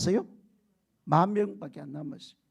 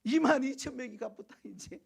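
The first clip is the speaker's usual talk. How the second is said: upset grumble; 5 semitones higher, 4 dB quieter than usual. Retold or imitated. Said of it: "속이 상했을 거예요".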